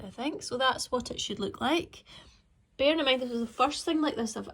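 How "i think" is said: ordinary talk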